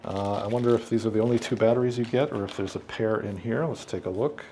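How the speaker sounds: ordinary talk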